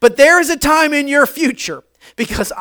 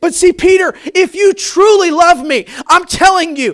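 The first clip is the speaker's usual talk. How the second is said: louder, higher, rising sermon climax; preaching at full force; 7 semitones higher, 6 dB louder than usual. Acted. Preacher voicing Jesus to Peter, full-force preaching. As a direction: drive it home to the congregation.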